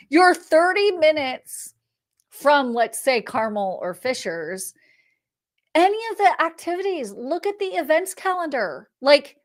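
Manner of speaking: usual speaking voice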